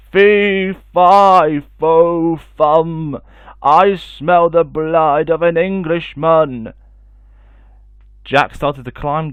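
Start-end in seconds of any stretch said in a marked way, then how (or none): 0.1-6.7 loud, slow giant's roar; booming giant. Acted. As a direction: boom each word like a hungry giant. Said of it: "the giant's loud booming voice".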